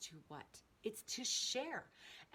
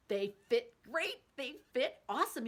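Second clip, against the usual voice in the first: much louder, thrilled and beaming; delighted; 4 semitones higher, 9 dB louder than usual. Real, not acted. To viewers, thrilled that her old jeans fit, louder and beaming. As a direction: gush about it like great news.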